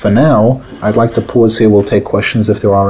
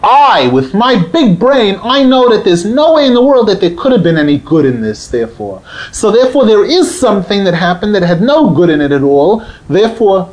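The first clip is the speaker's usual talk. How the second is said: smug sceptic; 9 semitones higher than usual. Retold or imitated. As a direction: play the big-brained doubter. Voicing a sceptic's argument.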